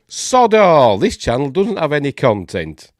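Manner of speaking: loud, high scoffing exclamation; scornful disbelief. Real, not acted. Checking the commenter's empty channel, scornful disbelief. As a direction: burst out at how little there is.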